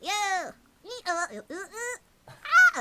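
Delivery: high, slow, halting robot noises; robot sounds. Shown as a demonstration. Voice actor showing the robot sounds she made, high and halting. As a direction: squeak out syllables like a little robot. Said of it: "So I just ended up going".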